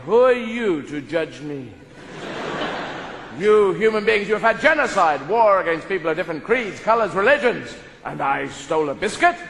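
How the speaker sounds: a dog defending himself to humanity; indignant dog speech. Pretend. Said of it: "Dog saying".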